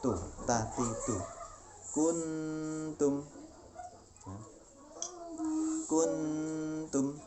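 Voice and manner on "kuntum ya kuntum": "Kuntum" higher, chanted with a long hummed n; ikhfa recitation. Shown as a demonstration. Teacher gives example of nun meeting ta, "Kuntum" with hummed n. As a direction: sustain the nasal ghunnah in "Kuntum" before tum. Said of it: "ini contoh non mati ketemu dengan".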